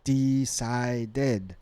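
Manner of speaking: "decided" sounded out as de-ci-ded, three separate beats; three syllables. Shown as a demonstration. Teacher counting syllables for students, three distinct beats in "decided". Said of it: "we got three syllables there".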